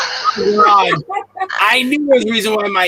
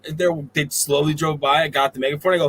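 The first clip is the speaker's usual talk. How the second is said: ordinary talk, nothing marked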